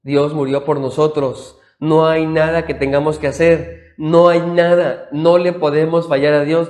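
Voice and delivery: slow, forceful preaching with weight on every word; impassioned sermon ending; preaching climax. Acted. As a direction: drive the final truth home to the congregation. Closing sermon emphasis.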